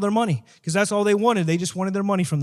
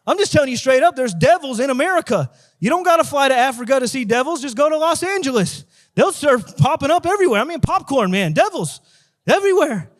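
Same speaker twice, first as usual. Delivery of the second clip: raised, emphatic preacher voice; fired-up preaching; 5 semitones higher, 4 dB louder than usual. Real, not acted. Preacher warning church about devils in America, fired-up preaching. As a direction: preach it hard, punching the key words.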